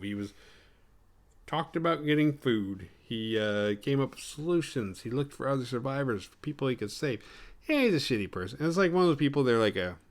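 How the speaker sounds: ordinary delivery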